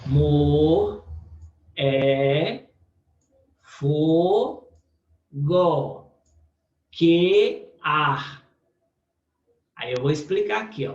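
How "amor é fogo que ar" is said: "Amor", "é", "fogo", "que ar" each set apart, slightly louder, long pauses; scanning syllables. Shown as a demonstration. Teacher dividing a sonnet verse into syllables, paused and deliberate. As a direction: tap out each poetic syllable slowly. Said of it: "vou separar aqui".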